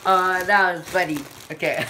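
high squeaky laughing voice; helium voice. Real, not acted. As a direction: talk through giggles on helium. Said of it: "My voice is so weird".

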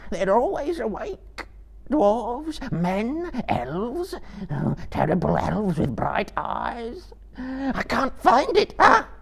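very high, sobbing wail. Acted. Gollum pleads with his unseen torturer, sobbing. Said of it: "he sobbed in his throat".

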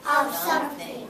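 whole class shouts "To form" back; loud choral repeat; class echo. Real, not acted. Students echoing the teacher, loud. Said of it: "Repeat after me".

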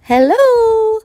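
high, slow hen call. A narrator's reading. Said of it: "she said".

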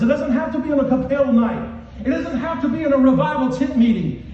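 high, forceful revival preaching; yelling preacher. Real, not acted. Preacher exhorting the church that Jesus meets them anywhere, yelling. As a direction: drive each phrase home loud and high. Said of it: "scream and yell at them like I'm doing".

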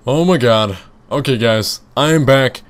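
loud, breathless return in a panic; real panic. Real, not acted. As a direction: burst back in rattled and loud. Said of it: "freaking out right now".

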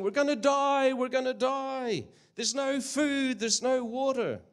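high, loud panicked yelling of a castaway; panicked screaming. Acted. Shipwrecked man yelling at his companion, panicked high yell. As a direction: shriek it out like a man sure he'll die. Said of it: "one started screaming and yelling".

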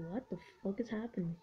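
soft, quick muttered confusion; quiet mock bewilderment; baffled voiced quote. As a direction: mutter it fast, as the baffled unit. Voicing a baffled unit.

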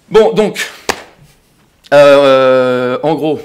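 loud, high, strained hesitation; exasperated. Acted. Man at the end of his tether, loud exasperation. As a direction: burst out, fumbling for the summary.

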